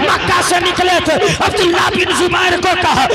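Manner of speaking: very loud, high sermon cry; preaching at full force. Acted. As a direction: thunder it out to the crowd.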